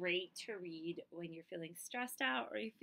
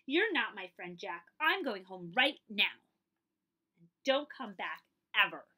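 loud, slow indignant scolding; storming off in a huff; angry outburst; 10 dB louder than usual. Acted. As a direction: tell your friend off, really hurt. Dolly furious at Jack's picture.

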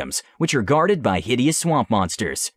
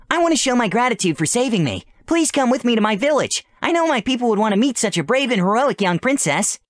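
very high, squeaky little voice; little mushroom creature, frightened; 12 semitones higher than usual. Acted. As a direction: speak like a tiny scared critter. Sporal invites her.

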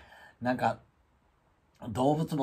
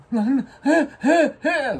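very high, loud frightened stammer; high alarmed stutter; panicked cat; 13 semitones higher, 9 dB louder, much slower than usual. Retold or imitated. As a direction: squeak out the cat's panic. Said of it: "ガー来てるわけ".

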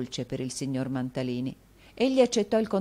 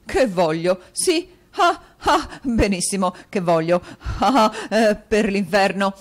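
louder, high laughing bluster; showing his teeth, laughing; 5 semitones higher, 8 dB louder than usual. Acted. Mantalini laughing off Rodolfo's question. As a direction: laugh it off with flourish. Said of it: "sfoggiando i denti".

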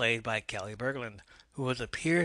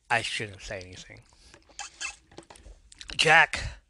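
plain reading voice

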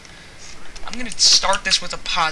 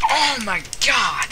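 higher, drawn-out exclamation; slow, stunned outcry at getting killed; real dismay; 4 semitones higher, slower than usual. Real, not acted. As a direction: stretch it out in disbelief. Killed during the game.

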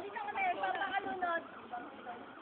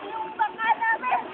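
much higher, playful repeat; high pitched; 7 dB louder than usual. Pretend. Pretend phone call in water.

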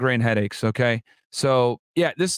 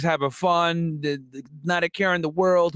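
ordinary delivery

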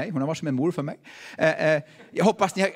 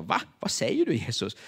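retold puzzled surprise; 6 semitones lower than usual. Acts out his puzzled reaction.

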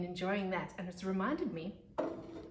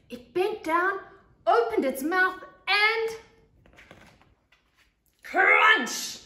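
rising higher and louder up to crunch; building to a climax; 11 semitones higher, 12 dB louder, much slower than usual. Acted. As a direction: build the suspense then bite. Climax of the monster's bite.

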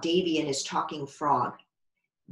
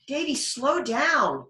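higher, slow call after him; girl calling after Davy; 7 semitones higher, slower than usual. Acted. Becky chasing Davy, calling him to slow down higher. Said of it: "Becky said".

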